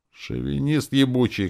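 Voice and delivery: high-pitched angry cry; yelled insult. A narrator's reading. Girl curses the druid, yelling. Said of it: "крикнула девушка".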